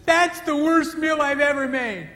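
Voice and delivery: high, slow, self-critical wife voice; a wife running down her own cooking in a high, slow voice. Retold or imitated. Wife after serving an evening meal, high and self-critical. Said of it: "afterwards she says".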